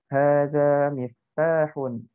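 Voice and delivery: Hadza baitun drawn out slowly, each syllable clear; slow model reading. Shown as a demonstration. Teacher modelling Arabic for the online class, slow and clear. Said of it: "ini kunci".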